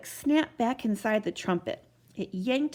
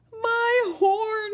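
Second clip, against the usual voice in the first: high, louder alarmed gulp; gulping in dismay; 12 semitones higher, 5 dB louder than usual. A narrator's reading. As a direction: choke out the shock. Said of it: "he gulped".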